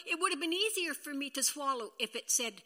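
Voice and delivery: ordinary talk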